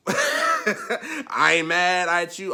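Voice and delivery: high, loud, drawn-out through a laugh; laughing reassurance. Real, not acted. Laughs while excusing others' choices.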